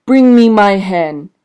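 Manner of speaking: loud, slow booming giant demand; slow, loud bellow of a giant calling for his hen; giant's bellow. A narrator's reading. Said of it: "the giant bellowed".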